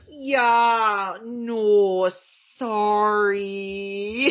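a light Canadian "yeah, no, sorry"; lightly voiced Canadian politeness, drawn out. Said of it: "I kept doing that voice today".

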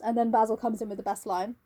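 ordinary talk, nothing marked

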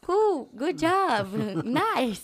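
slow, loud, laughing fake enthusiasm; hammed-up breezy cool good job nice; mock empty praise. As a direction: drag out hollow praise with a laugh. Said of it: "Ele só ficava".

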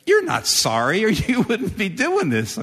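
fed-up victim scoffing at a sixth apology; exasperated retort. Acted. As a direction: call the bluff with a laugh. Exasperated retort to repeat offender.

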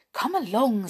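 mildly jittery brownie; nervous. A narrator's reading. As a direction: hurry them along uneasily. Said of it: "said Skip nervously".

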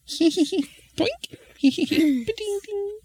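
very high squeaky hee-hees and boinks; imp giggle and coin noises. Acted. Imp sneakily dropping coins, high giggly sound effects. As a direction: giggle mischievously, then imitate bouncing coins.

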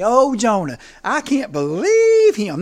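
call and response. Shown as a demonstration. Preacher leading the congregation in a slow, loud refrain. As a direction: lead the church in saying it, slowly and loud. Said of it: "have the church say it with me".

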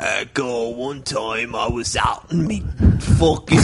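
low, soft British voice; mock British accent. Cohost telling a story, low British accent. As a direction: start a yarn as a Brit. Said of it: "British guys that talk like this".

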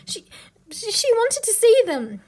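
high nervous stammer; frightened boy faltering; trembling. A narrator's reading. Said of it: "trembling so that he could hardly speak".

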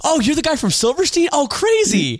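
high-pitched imitated astonishment; mock surprised recognition. Clueless guest realising who phoned, mock surprised.

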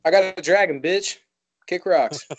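high, loud swaggering brush-off; cocky dismissive Jon. Retold or imitated. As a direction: taunt like a smug dragon owner. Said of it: "Just like".

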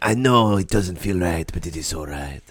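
pleading in bad French accent; hammy French suitor; horrible French accent. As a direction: lovelorn mock French, softening at the end. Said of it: "That's a horrible French accent".

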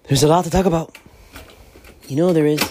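loud, high hype; loud excited teaser; hyped up. Acted. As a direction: pump up the audience. Hyping big topic.

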